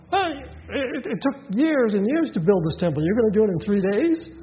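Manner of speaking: high, mocking disbelief from the crowd; scoffing. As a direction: scoff at the idea. Temple crowd scoffing at Jesus.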